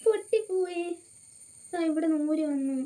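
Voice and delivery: high, drawn-out little wail; dismayed whine. Real, not acted. Vlogger shows viewers something just broke, high dismayed whine. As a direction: lament it in a high, slowing whine.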